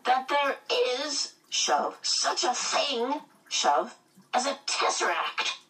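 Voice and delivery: put-on high, slow old woman pushing out words; effortful old woman. A narrator's reading. Eccentric old woman forcing on her boot, strained. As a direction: strain each phrase between shoves.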